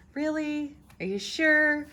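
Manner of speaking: high-pitched mock doubt; doubting viewers. Reader voicing viewers unsure of the cards, doubtful. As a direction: question it in a high, incredulous voice. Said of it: "some of you who are saying".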